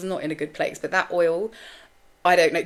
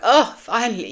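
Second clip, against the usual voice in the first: louder, higher relieved "oh"; mock relief; 6 semitones higher, 8 dB louder than usual. Receptors to the person who fed them, mock relief. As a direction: exclaim it like a long-awaited relief.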